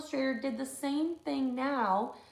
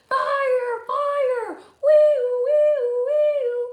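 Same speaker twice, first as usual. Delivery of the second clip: very high, loud sung siren; siren noise; 13 dB louder, much slower than usual. Acted. Luther playing fire engine, high wailing siren.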